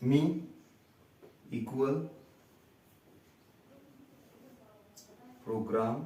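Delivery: teacher dictating example words. Shown as a demonstration. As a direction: leave long gaps between each word. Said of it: "teacher dictating example words".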